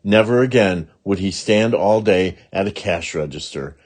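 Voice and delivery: plain reading voice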